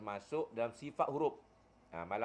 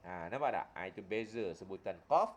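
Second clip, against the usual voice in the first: ordinary talk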